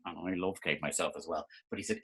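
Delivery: ordinary delivery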